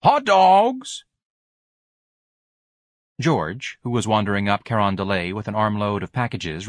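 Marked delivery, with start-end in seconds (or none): 0.0-1.0 high, loud street cry; hawking hot dogs at the top of his voice; vendor's call. A narrator's reading. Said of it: "calling again".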